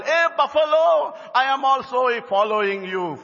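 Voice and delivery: high-pitched call to the buffalo; elephant calling out. Acted.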